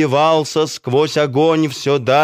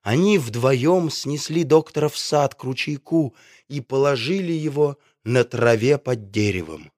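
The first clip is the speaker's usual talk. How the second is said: plain reading voice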